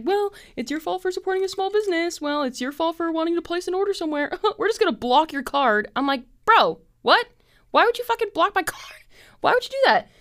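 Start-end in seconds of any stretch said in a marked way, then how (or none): 0.0-5.9 high, smug customer-service voice; mock the bank's condescension. The bank lectures her for her small-business order, mock smug. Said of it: "now the bank is like". 6.4-10.1 high, loud exasperated outburst; hammed-up fury at a blocked card; mock outrage. She snaps back at the bank over her card, mock furious.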